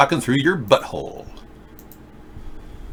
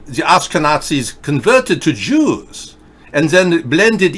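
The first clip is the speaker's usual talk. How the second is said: higher-pitched put-on German accent; 5 semitones higher than usual. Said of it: "I have a German accent".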